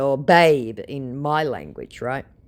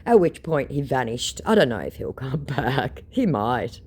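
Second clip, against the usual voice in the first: normal talking voice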